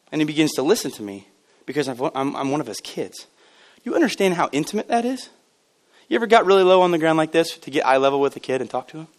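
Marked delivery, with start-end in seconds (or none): none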